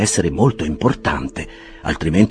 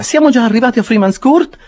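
much higher puzzled lady; Mrs Bardell asking; 6 dB louder than usual. A narrator's reading. Mrs Bardell asking the clerk where they are, high voice. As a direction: ask with surprise. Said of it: "disse la signora".

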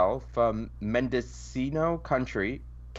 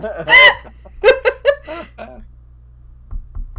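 high, loud burst of laughter; loud shrieking laugh; 14 dB louder than usual. Real, not acted. Joke about fire.

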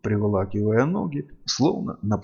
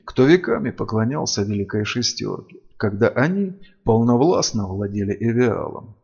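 ordinary delivery

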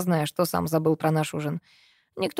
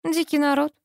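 lightly voiced, higher, unbothered woman; phlegmatic female voice; 9 semitones higher than usual. A narrator's reading. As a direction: say it dry and indifferent, pitched up. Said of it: "флегматично ответила она".

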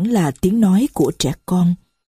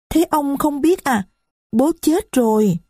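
put-on higher, small voice of a young girl; little girl's voice; 8 semitones higher than usual. Little Marie complains to the stranger, high child voice. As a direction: speak as a little child who is hurt. Marie says father died.

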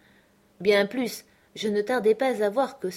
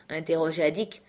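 ordinary delivery, nothing marked

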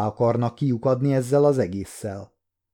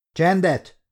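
judge shouting; 6 semitones higher than usual. A narrator's reading. Judge demands silence from murmuring courtroom, raised call. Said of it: "kiáltotta".

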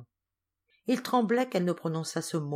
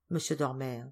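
ordinary delivery

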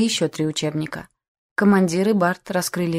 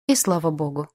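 plain reading voice

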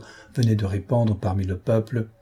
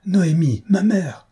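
higher, urgent call to his mother; anguished cry; 9 semitones higher, 4 dB louder, slower than usual. A narrator's reading. Son rushing to his injured mother, anguished cry. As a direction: reach her with your voice, frantic. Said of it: "s'écria Saint-Georges".

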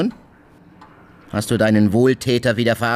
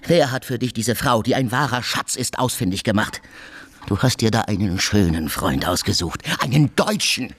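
ordinary talk, nothing marked

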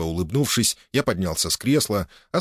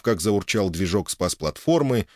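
plain reading voice

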